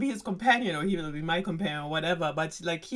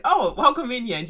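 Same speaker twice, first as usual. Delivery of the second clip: excited king; 6 semitones higher, 9 dB louder than usual. Retold or imitated. King voiced, sudden.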